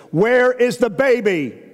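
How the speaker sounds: insistent repeat. Retold or imitated. Sleepwalking wife staring at her husband, high drawn-out plea. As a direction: plead it high and slow, pressing the question. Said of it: "and she goes".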